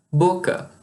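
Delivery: ordinary delivery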